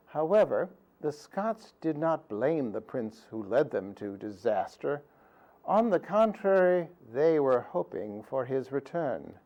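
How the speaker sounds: usual speaking voice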